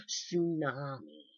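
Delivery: tsunami drawn out slowly, savored; big word. Shown as a demonstration. Showing off a newly learned word, tsunami slow. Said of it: "I learned that word".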